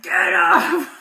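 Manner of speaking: high, loud, gruff little shout; gruff toddler shout. Retold or imitated. Toddler ordering someone away, gruff shout. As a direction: bark it like a tiny boss. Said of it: "in this really gruff voice".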